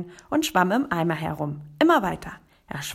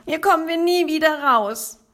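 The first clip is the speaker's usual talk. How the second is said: high, loud hopeless lament; wailing frog; 9 semitones higher, 5 dB louder than usual. Acted. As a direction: moan that there is no way out. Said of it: "jammerte er".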